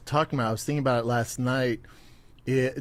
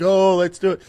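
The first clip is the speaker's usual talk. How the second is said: keen, louder, higher call of let's do it; eager quote; 6 semitones higher, 8 dB louder than usual. Retold or imitated. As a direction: friend eagerly jumping in on the fight offer, louder and higher. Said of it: "Brian's like".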